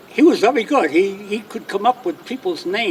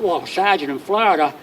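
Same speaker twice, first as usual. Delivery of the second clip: ordinary talk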